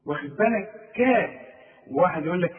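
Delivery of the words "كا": كا said thin and light, a bright front a; a clean, thin كا; light kaf. Shown as a demonstration. Teacher showing the correct thin kaf. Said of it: "الكاف مرققة".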